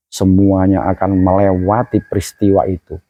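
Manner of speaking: ordinary talk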